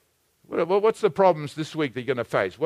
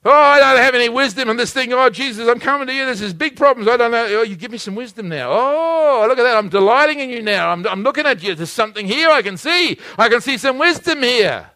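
loud, high, fervent sermon delivery; pulpit-pounding preaching voice; full-force preaching; 9 semitones higher, 8 dB louder than usual. Acted. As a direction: preach it out big to the congregation.

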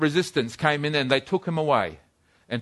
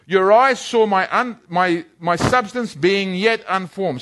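solemn scripture reading; 4 semitones higher than usual. Acted. Preacher reading Psalm to congregation, solemn raised reading. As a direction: read the Psalm as a reverent proclamation.

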